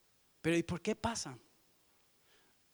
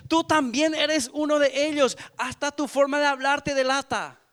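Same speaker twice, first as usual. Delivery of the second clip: accusing; 5 semitones higher, 7 dB louder than usual. Acted. Last accuser exposing Peter.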